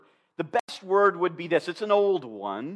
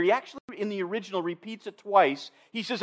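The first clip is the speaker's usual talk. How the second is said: ordinary talk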